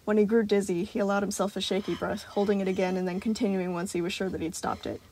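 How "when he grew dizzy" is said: usual speaking voice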